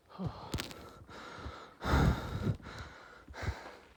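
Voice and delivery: long, heavy puffing exhale; big breathless sigh; out-of-breath sigh. Real, not acted. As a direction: blow out air, winded from talking. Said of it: "I can't even breathe".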